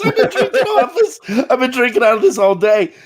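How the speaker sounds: high, giggling mock panic; laughing while imitating her panic; laughing imitation. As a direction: squeal it out through laughter. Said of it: "She goes".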